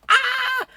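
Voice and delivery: very high, loud cartoon "ah!"; comic startled mouse shriek; imitated mouse scream. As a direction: squeal in mock terror. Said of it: "this little mouse looked at me".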